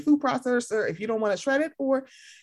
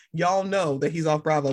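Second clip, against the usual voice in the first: usual speaking voice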